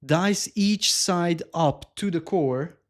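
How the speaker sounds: dice each side up to the core spelled out very slowly; slow confused re-reading; slow puzzled reread. Real, not acted. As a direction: read it back word by word, baffled.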